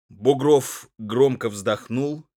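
ordinary delivery

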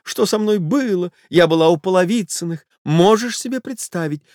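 high, breathless society lady voice; a frightened wife begging him off; flustered lady. Acted. Wife pleading with her lover to leave, high flustered voice. Lady's voice in the story.

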